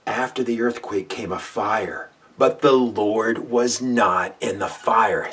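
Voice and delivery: loud, booming preacher reading of the passage; dramatic scripture reading. Acted. Preacher reading First Kings to listeners, booming. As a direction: proclaim it like thunder over the mountain.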